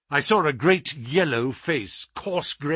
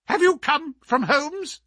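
much higher, sharp and tense; high alert voice; 13 semitones higher than usual. Acted. The scholar pressing the doctor about Holmes, high and tense. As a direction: snap the question up high, suddenly alert.

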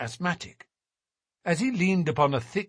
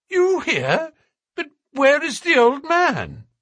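astonished; 6 dB louder than usual. A narrator's reading. Watson astonished at reveal.

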